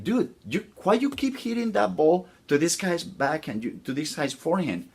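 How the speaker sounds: high-pitched challenge from a puzzled friend; incredulous friend. Acted. Friends on bleachers challenging the player.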